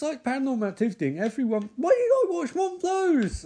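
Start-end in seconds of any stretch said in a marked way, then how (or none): 1.8-3.4 much higher, squeaky mock panic; mock squeaky viewer. Mocking horror characters who watched the footage, in a high squeaky mock voice. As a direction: squeal it like a silly horror fan regretting the film.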